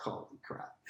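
a hushed whisper of shock; stunned whisper. Retold or imitated. Batsman learning his score, whispering in disbelief. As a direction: breathe it out in disbelief.

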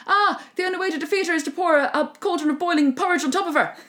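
high, loud, hurried cry, half laughing; hammed-up panicked shout with laughter; hurried call-out. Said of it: "Snatch calls out really quickly".

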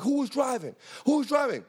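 high, insistent officer's demand; retold urgent interrogation; police questioning. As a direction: act out an officer demanding an answer.

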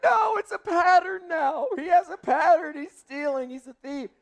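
half-crying alarm over the thief son; upset shout. Retold or imitated. Wife's alarmed outburst retold.

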